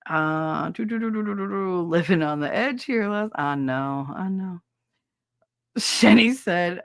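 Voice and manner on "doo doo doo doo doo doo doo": usual speaking voice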